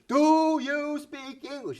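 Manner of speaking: hammed-up yell, as if volume makes it clear. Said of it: "if they say it louder".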